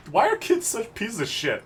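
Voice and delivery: hammed-up slow angry yell; exasperated rant. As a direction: vent at the kids in disgust.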